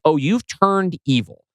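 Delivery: normal talking voice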